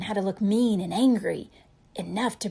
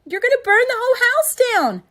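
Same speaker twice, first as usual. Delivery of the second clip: loud, high, fast frantic cry; mother crying out at her husband; alarmed cry; 10 dB louder, faster than usual. A narrator's reading. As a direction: shriek a warning at him. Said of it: "cried his mom".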